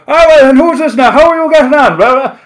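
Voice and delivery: high, loud, garbled Carlow cabbie; imitating an incomprehensible Carlow taxi driver's accent; thick Carlow accent. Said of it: "this taxi driver has a Carlow accent".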